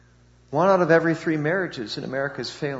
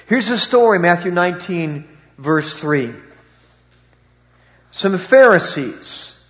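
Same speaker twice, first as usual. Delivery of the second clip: ordinary delivery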